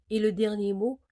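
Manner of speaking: ordinary delivery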